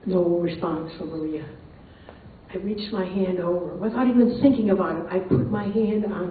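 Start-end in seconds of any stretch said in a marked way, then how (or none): none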